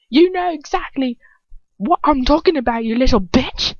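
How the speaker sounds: high, loud snarling villain; furious hiss. A narrator's reading. Dolohov raging at the lying girl, high furious snarl. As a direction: snarl it at her in rage. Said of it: "He hisses".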